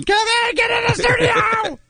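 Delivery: yelling. Retold or imitated. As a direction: shout for him to get in. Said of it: "people are yelling".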